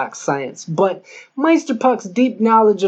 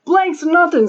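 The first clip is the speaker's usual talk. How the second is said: heated retort; 9 semitones higher than usual. Acted. Gangsters arguing over missed shots.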